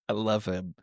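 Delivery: normal talking voice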